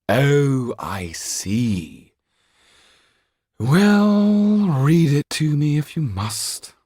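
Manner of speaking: high lofty sigh, slow and grudging; bored Marquis. Acted. Marquis letting the lawyer read, grudging and lofty. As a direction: grant it as a great favour.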